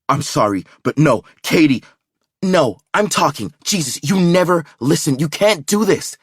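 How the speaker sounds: high, loud angry outburst; heated argument. A narrator's reading. Girlfriend arguing back on phone, angry and loud. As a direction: push back hard, won't be talked over.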